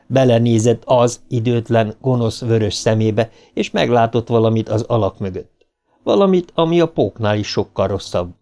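plain reading voice

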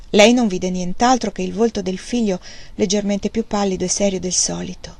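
ordinary delivery

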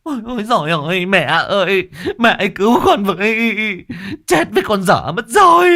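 high, fast terrified wail; panicked shriek. Acted. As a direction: shriek for your mother in panic. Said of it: "được một phen rú lên".

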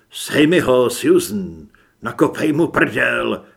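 loud, urgent yell; shouted egging-on; shouting. A narrator's reading. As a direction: yell it as an order to an ally. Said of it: "křičel jsem".

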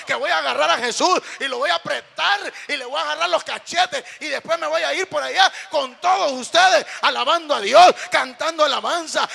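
high, very loud rhythmic climax; full-force preaching. Real, not acted. Preacher picturing himself with Jesus in heaven, full-force preaching. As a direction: proclaim heaven's joy at full voice.

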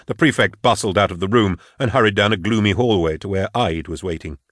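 plain reading voice

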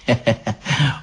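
short, soft hoarse witch chuckle; low creaky heh-heh-heh. A narrator's reading. Baba Yaga relishes her supper, hoarse chuckle. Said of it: "засмеялась хрипло".

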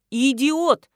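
talking crow. A narrator's reading. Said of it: "ответил знакомый голос".

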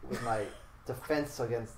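weary, deflated delivery, fading off; defeated and bludgeoned. Real, not acted. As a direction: sound exhausted and beaten down. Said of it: "defeated and bludgeoned".

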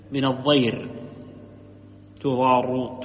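usual speaking voice

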